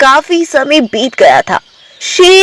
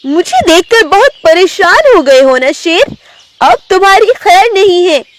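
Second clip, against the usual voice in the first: high-pitched, taunting tigress; threatening tigress; 7 semitones higher than usual. Acted. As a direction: confront the lion with a high, menacing taunt. (ऊँची आवाज़ में धमकाती बाघिन)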